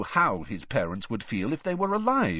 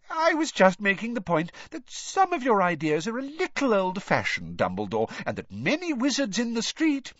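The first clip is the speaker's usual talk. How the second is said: Rita Skeeter; 12 semitones higher than usual. A narrator's reading. Rita defends her article.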